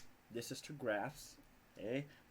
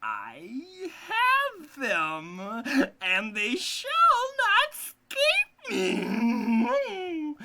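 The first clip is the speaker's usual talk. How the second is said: high, loud, very slow witch voice; triumphant witch; 13 dB louder, much slower than usual. A narrator's reading. Witch gloating that the children cannot escape, loud high cackling voice. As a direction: crow it out like a gloating old witch. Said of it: "said triumphantly".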